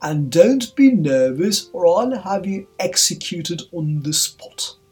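threatening king. A narrator's reading. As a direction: threaten him at full voice.

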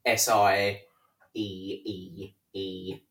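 drawn-out Palpatine spelling; pompous senator oratory. Retold or imitated. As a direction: stretch each letter slowly, villain-style. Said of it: "taking the piss out of Senator Palpatine's voice".

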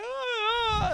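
high-pitched "ah" of fake crying; hammed-up sob; mock crying wail. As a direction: imitate a child bawling, drawn out. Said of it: "like, crying".

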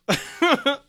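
high, loud, laughing comeback; laughing retort. Real, not acted. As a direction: laugh out the tease.

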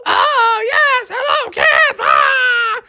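put-on high, squealing old-lady voice; acted overjoyed greeting; delighted granny. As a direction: gush at the grandkids in falsetto. Granny greeting the excited kids.

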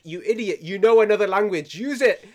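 inner scold. Retold or imitated.

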